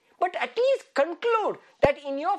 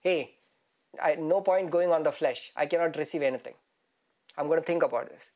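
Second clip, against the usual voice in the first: much lower, musing voice; thinking to oneself; 10 semitones lower than usual. Acted. Man giving up on the flesh, low and musing. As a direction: think it through aloud, pitched right down. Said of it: "He's now thinking about it".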